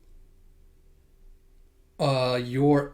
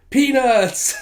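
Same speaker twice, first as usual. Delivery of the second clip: much higher, louder proud shout; excited reveal; 11 semitones higher, 8 dB louder than usual. Acted. Anya showing Becky her snack, high excited shout. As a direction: announce it like a treasure.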